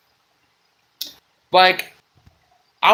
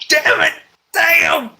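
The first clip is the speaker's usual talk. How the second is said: high, squeaky frustrated curses; exasperated high-pitched outburst; frustrated yelps; 11 semitones higher than usual. Real, not acted. Frustrated at flubbing the line.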